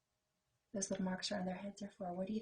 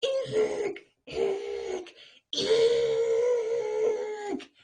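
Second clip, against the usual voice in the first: high, loud squeals; squealing eek; 13 dB louder, much slower than usual. Acted. Sam squealing at Carrie. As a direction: shriek in fright. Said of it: "yelled Sam".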